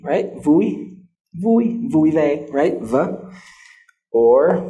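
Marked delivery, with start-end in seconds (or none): 0.4-2.5 vui said higher each time, clear v; repeated vui. Shown as a demonstration. Teacher drilling the v in vui. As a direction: sing out vui vui vui ve rising in pitch. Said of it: "V as in vui".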